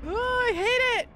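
high, loud panicked cry; panicked shout. Real, not acted. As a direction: yell it out in panic. Panics in small spaces.

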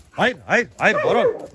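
high, loud barked no at a horse; commanding shout. Real, not acted. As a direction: stop the horse right now. (yüksek, sert bağırış)

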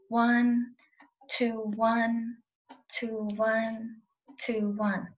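slow, even count of one and two; counting the beat. Shown as a demonstration. Violin teacher counting vibrato sets for a student, steady. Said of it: "do first in sets of two".

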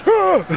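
high startled yelp; mock scared shriek; imitated startled yelp. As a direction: squeal it like a scared kid. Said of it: "He goes".

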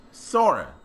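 usual speaking voice

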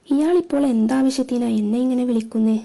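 plain reading voice

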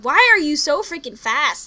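real annoyance; exasperated. Real, not acted. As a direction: yell at the fast zombie. Yelling at zombie.

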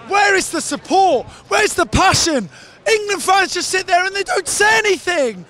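real indignant rant, pitched high; exasperated, rising into a high protest; irate. Real, not acted. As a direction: demand answers in high-pitched outrage. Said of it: "a rather irate England fan".

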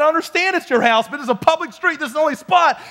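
arguing loudly about a public street; yelling back. Retold or imitated. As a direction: shout the argument fast. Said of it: "we started just kinda yelling back and forth".

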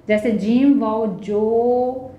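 usual speaking voice

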